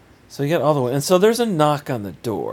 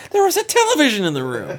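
laughing; faster than usual. Real, not acted. Laughing disbelief.

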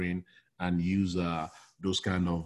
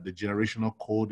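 ordinary talk, nothing marked

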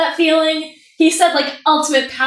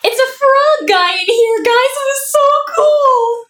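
excited; 10 semitones higher, 4 dB louder, slower than usual. Real, not acted. Telling friends about the frog.

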